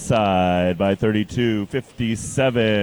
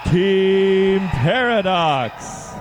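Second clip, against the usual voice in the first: higher, slow shouted team call; fighting game announcer; 5 semitones higher, much slower than usual. Acted. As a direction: hype the final team to the crowd. Robot teams introduced at competition.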